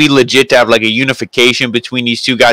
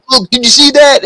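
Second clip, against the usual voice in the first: higher-pitched mock freak-out; 12 semitones higher than usual. Retelling the commentator's reaction to the knee brace, freaking out. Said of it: "he's like freaking out".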